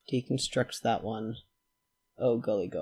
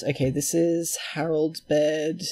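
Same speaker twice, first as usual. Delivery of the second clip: ordinary delivery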